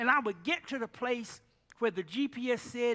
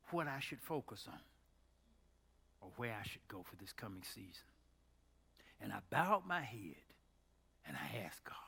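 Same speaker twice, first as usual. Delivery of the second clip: much softer, lower, almost whispered; hushed confession; 8 semitones lower, 13 dB quieter than usual. Retold or imitated. Quiet confession to Earl.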